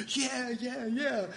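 high, soft mock-eager agreement; eager spirit. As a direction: voice an inner cheerleader, lightly. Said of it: "my spirit was going".

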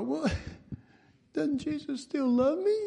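high, laughing mock plea; lightly voiced whining believer; whiny churchgoer. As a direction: play the hurt believer, half laughing. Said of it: "we all go".

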